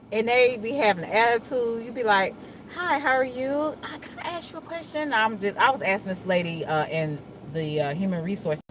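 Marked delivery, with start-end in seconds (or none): 2.7-5.1 high, sweet polite phone opener; softer approach. Acted. Asking someone with an attitude a question, softly polite. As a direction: ask softly and high, with careful courtesy. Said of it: "I try to come in with a softer approach".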